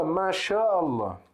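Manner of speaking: slow, drawn out "Mashallah" with a long aa; Arabic pronunciation. Shown as a demonstration. Teacher showing students the phrase, slow and drawn out. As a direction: stretch out "Mashallah" for the learners. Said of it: "a gente fala".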